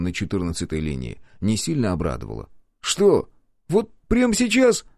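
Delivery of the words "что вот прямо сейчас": high, louder startled outcry; dismayed protest. A narrator's reading. Паша возмущённо удивляется срочному заданию. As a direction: sound put out by the sudden order.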